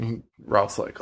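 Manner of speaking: ordinary talk, nothing marked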